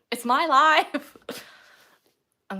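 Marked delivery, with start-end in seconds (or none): 0.1-1.2 loud, slow, playful defiance; hammed-up stubborn declaration; mock-defiant outburst. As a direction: draw it out and belt it back at chat. Mock-defiant reply to chat arguing.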